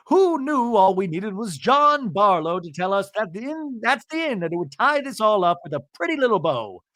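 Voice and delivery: high, singsong false wonder; mock-gushing sarcasm. [name] on men solving women's problems, mock-sweet and sarcastic. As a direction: coo it with exaggerated sarcasm.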